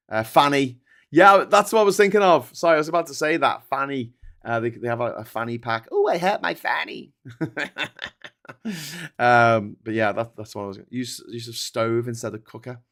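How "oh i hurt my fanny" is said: a lightly voiced, higher mock American; lightly hammed-up American complaint. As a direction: play an American innocently saying fanny. Imitating an American saying fanny.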